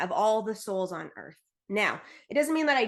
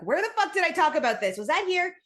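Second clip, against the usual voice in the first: baffled self-quote; 5 semitones higher, 4 dB louder, faster than usual. Retold or imitated. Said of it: "I was like".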